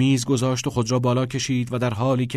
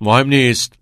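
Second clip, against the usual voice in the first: curt dismissal; 3 semitones higher, 9 dB louder than usual. A narrator's reading. Teacher cuts pupil off.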